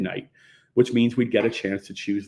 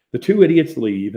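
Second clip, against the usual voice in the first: ordinary delivery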